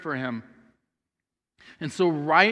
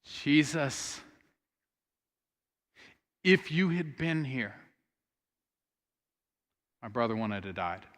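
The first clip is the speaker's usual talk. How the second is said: softly grieving plea; 4 semitones lower, 6 dB quieter than usual. Retold or imitated. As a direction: let the grief sit on every word. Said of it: "she says".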